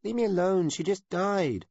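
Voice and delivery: put-on high, loud child voice on the edge of crying; a boy shouting through tears to be left alone; tearful child. A narrator's reading. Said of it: "Are you crying?".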